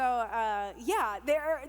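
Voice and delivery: normal talking voice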